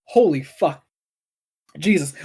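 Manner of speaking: just choked, throat still irritated; recovering from a coughing fit; something caught in throat. Real, not acted. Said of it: "Something totally got caught in my throat".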